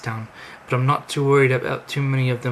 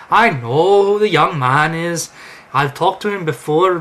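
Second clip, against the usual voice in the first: wife cutting in; 3 semitones higher, 8 dB louder than usual. Acted. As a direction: cut in on your husband, loudly. Wife cuts in on the introduction.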